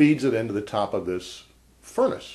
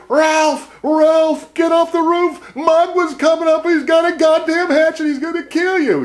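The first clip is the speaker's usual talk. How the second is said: higher, much louder panicked warning shout; frantic yelling; 10 dB louder than usual. Retold or imitated. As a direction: scream the warning up to the roof. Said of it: "the foremen are yelling".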